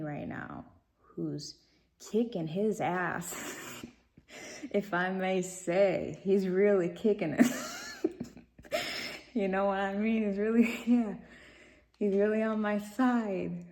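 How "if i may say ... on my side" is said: real giggly delight, louder than usual; laughing. Real, not acted. Bragging to viewers about her attorney, laughing. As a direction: talk through bursts of giggles, louder.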